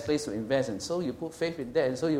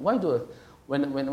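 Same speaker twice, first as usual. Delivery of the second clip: usual speaking voice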